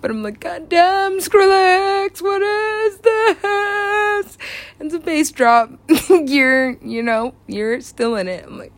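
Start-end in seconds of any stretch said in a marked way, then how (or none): 0.4-4.4 high, very drawn-out wail of awe; acted overwhelmed exclamation; awestruck outcry. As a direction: stretch the last words way out, high. Acting out awe at the drop.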